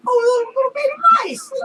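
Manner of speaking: high, fast squealing cry over cute mice; mock squeal. Workers cooing over baby mice, high squealing shout. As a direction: squeal like you're melting over babies.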